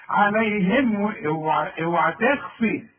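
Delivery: ordinary talk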